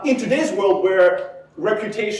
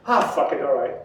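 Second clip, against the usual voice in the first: grudging give-in, falling lower at the end; resigned kid; 6 semitones lower than usual. Acted. Kid giving in to parent at bedtime, resigned. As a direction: give up as a worn-down child. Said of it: "the kid just goes".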